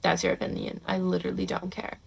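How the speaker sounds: soft, low, half-whispered indifference; unbothered brush-off. Pretend. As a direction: let it slide off you quietly. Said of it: "be like".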